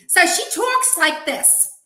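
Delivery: a high, slow, hammed-up New Jersey lady voice; New Jersey accent. Host mimicking a New Jersey colleague for her guest, in a hammed-up New Jersey accent. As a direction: mimic a New Jersey coworker's way of talking, slowly. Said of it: "is a New Jersey lady".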